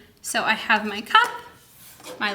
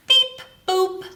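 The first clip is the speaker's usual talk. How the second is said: high robotic beep boop; 9 semitones higher, 3 dB louder than usual. Acted. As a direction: chirp it like a little scanner. Scanners beeping at old woman.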